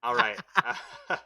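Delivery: high, loud laughing all right. Real, not acted. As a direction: still giggling as you say it. Laughing after a joke.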